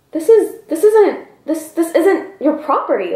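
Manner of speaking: high-pitched, stammering disbelief; flustered protest. Acted. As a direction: stumble into a baffled, high objection. Retold shocked thought at man approaching.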